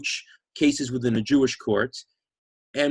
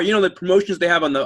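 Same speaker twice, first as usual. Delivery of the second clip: ordinary delivery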